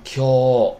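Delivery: 教 read with a drawn-out long vowel, loud. Shown as a demonstration. Teacher showing students the long sound, 教 held long. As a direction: hold the vowel of 教 long, Japanese style. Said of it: "长音，对吧？".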